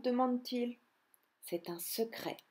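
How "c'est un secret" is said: soft, quieter, smiling mother; smiling secret. Acted. Mother squirrel teasing her children, soft and smiling. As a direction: keep it a sweet little secret. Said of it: "répond-elle en souriant".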